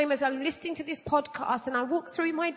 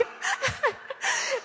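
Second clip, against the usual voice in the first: squeaky laugh that tips into a sob; high laugh breaking into a cry; 11 semitones higher than usual. Real, not acted. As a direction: laugh until it almost turns to tears. Laughing at own story.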